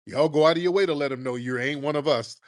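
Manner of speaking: usual speaking voice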